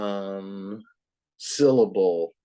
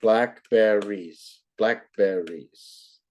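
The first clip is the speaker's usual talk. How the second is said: slow syllable demo; slower than usual. Shown as a demonstration. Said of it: "Three syllables".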